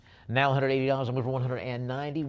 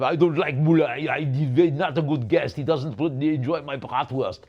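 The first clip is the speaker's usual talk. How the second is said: Arnold; 3 semitones higher, 8 dB louder than usual. Pretend. Auctioneer clowning about a footballer guest, loud mock Austrian voice. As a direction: boom it out in a hammed-up German accent. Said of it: "Who knew Arnold made a, made a, a mean bratwurst?".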